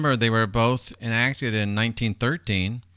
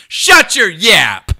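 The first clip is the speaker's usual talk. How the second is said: sudden loud barked yell, much higher, slow; mock yell; 10 semitones higher, 14 dB louder, much slower than usual. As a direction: snap it out like telling someone off. Sudden outburst at someone in chat.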